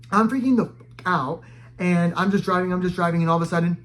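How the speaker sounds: ordinary talk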